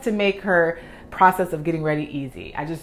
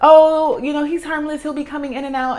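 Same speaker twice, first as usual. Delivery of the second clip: high-pitched imitation of a reassuring client; breezy client; 10 semitones higher, 6 dB louder than usual. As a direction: brush it off in a high voice, playing the client. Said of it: "I think she mentioned".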